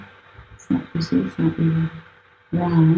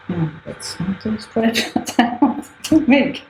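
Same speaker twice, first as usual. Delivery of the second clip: higher voice tipping into a laugh; 6 semitones higher than usual. Real, not acted. Artist spots a wrongly drawn stripe, laughing. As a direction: laugh at your own slip mid-sentence. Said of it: "I have to laugh about it".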